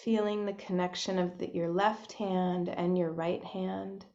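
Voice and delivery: soft, unhurried meditation voice with long pauses; hushed, spacious guidance into breath and body; guided meditation. Real, not acted. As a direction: gently guide listeners inward, leaving space between phrases.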